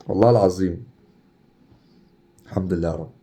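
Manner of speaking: normal talking voice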